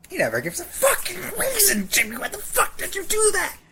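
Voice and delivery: exasperated high rant. Real, not acted. Podcaster ranting at the cartoon boy, high exasperated voice.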